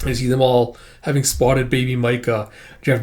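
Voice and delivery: ordinary delivery, nothing marked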